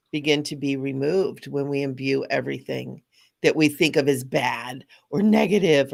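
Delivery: usual speaking voice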